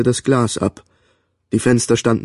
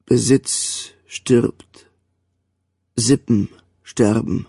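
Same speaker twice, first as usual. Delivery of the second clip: very slow, grave verse reading; solemn recitation; slower than usual. A narrator's reading. The commodore reciting the Edda for the fallen, solemn and slow. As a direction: intone each line like an epitaph.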